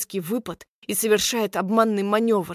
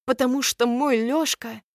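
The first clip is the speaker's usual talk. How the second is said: ordinary delivery, nothing marked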